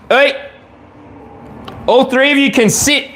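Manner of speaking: high firm pet-owner voice; commanding the dogs. Real, not acted. Owner orders her three dogs to sit and hush, high firm voice.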